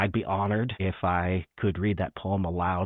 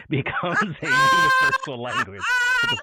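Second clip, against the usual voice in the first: laughing; 3 dB louder than usual. Real, not acted. Delighted remark, laughing mid-line.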